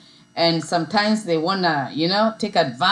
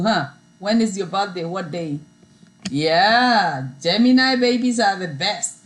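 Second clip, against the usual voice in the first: ordinary talk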